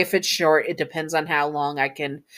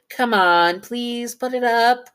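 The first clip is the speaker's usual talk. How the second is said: pleading; 6 semitones higher, slower than usual. Acted. Pleading for help with decorating.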